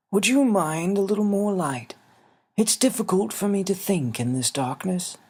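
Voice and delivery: put-on a small old man asking kindly; little winged man. Xavier's voice in the story.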